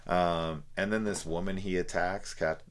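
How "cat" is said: normal talking voice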